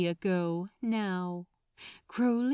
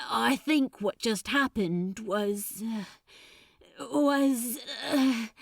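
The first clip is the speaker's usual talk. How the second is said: halting cracked voice. A narrator's reading. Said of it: "His voice was oddly cracked".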